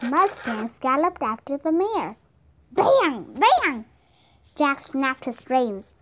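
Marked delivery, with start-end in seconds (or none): 2.7-3.9 high, punchy gunshot words; sudden raised bursts like shots; voiced gunshots. A narrator's reading. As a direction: fire each one out loud.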